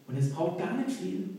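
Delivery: ordinary talk, nothing marked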